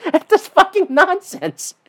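high-pitched, slowed, laughing through the words. Retold or imitated. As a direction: crack up mid-sentence at the nonsense. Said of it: "He's like".

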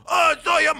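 teen recalls a biker calling out, mock voice; imitating biker. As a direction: toss it out like the meth biker spotting a kid.

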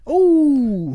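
loud, high pained ooh; wincing ooh. Real, not acted. Reacting to grandma burning his hand with a spoon, pained ooh. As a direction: wince as if you felt the burn.

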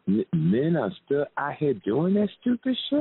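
high, rising disbelief; can't believe what you heard; incredulous. Retold or imitated. Retelling own shocked reaction to story.

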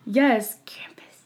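unvoiced spooky whisper; leaning in to whisper. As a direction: hush it like a secret name. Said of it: "Why are you whispering?".

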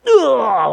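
choking grunt. Retold or imitated. Dog jerked short on chain.

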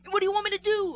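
mock upset outburst, high and loud; hammed-up exasperated yell; Ryan Gosling. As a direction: burst out, imitating an upset leading man. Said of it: "he gets, like, upset".

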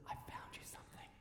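hushed, secretive whisper; barely audible whisper. Retold or imitated. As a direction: whisper it as if prey might hear. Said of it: "and he whispers".

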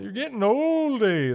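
high, loud, hammed-up Canadian accent; old Canadian guy. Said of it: "that's like an old Canadian guy".

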